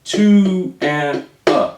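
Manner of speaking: drawn-out One and a; counting a slow triplet. Shown as a demonstration. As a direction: stretch each subcount evenly. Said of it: "Left hand".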